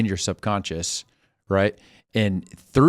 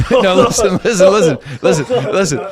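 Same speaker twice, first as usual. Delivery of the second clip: high, loud plea amid laughing; protesting through laughter; 11 semitones higher, 10 dB louder than usual. Real, not acted. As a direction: insist on being heard over the laughter. Protesting over laughter at impression.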